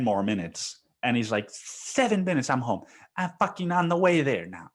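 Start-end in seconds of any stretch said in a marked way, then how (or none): 1.5-4.7 mock frantic boss. Boss lying to wife.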